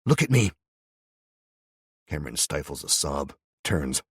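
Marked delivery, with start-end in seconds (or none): 0.1-0.5 stern order. Acted. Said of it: "she orders him".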